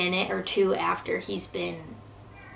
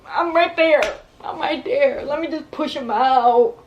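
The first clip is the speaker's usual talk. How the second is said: higher and louder, urgent begging; desperate pleading; 6 semitones higher, 5 dB louder than usual. Retold or imitated. Begging the doctors to let her push, urgent and high. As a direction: plead like you can't wait another second.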